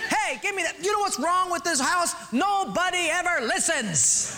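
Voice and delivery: preacher hams up a beer-belly husband; mock yelling husband. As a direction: holler from the armchair. Husband voiced in joke story.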